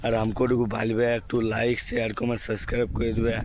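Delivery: sore-throat voice. Real, not acted. Vlogger apologising to viewers for a bad throat, weak quiet voice. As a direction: greet viewers softly through a worn-out throat. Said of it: "আজ গলা বসে গেছে".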